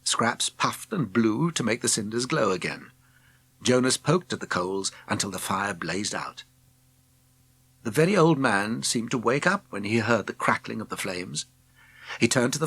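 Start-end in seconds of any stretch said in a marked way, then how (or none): none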